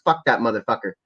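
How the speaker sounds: normal talking voice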